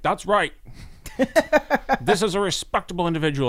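high, loud old politician impression; Bernie. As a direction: pipe up loudly in agreement, pitched well above normal. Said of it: "And Bernie's like".

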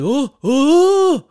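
high, loud drawn-out "oooh"; imitated whooping "oh". Imitating the reaction to a blast for a friend, high loud whooping. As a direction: hoot a mock reaction, rising way up.

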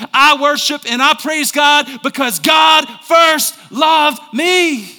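high, loud sermon climax; fervent preacher at full volume; full-force preaching. Real, not acted. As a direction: shout it to the whole church, drawing out the last words.

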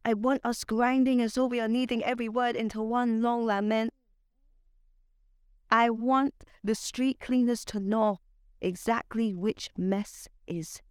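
measured spoken word delivery; poetry reading. Acted. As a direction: perform it like a slam poem, savoring lines. Spoken word poem recital.

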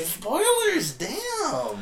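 high-pitched, hammed-up protest; mock outrage. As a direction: cry out in playful outrage at being spoiled.

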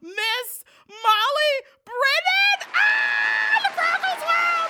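high, loud, stretched name call; announcer shout. Acted. Announcing guest, crowd-cheer shout.

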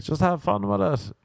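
high, lilting imitation of buddies; mock encouragement. Buddies urge him to enjoy the audition, mock-breezy. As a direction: pitch it up like a breezy pal. Mimics his buddies' advice.

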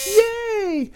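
very high, squeaky germ cheer; yelled cheer. A narrator's reading. Cold germs cheer being sawn loose, high squeaky yell. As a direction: squeal it like a tiny freed germ. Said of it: "yelled the cold germs".

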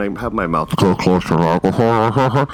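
plosive pops right into the mic; popping p sounds. Real, not acted. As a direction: mouth close to the microphone. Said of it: "I apologize for all the pops and whistles".

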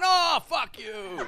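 high, slow drawn-out put-down; dismissive heckle. Retold or imitated. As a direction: stretch it out like a lazy jeer. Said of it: "you hear Dave going".

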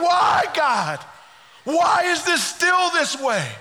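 high, drawn-out anguished plea; crying out. Acted. Preacher as grieving Nehemiah pleading with God, crying out. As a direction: cry it up to God in protest. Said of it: "he's crying out to God".

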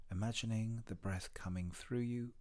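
usual speaking voice

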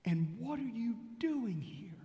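slow, breathy whispered question. Shown as a demonstration. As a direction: whisper it like a secret. Preacher echoing the question hushed.

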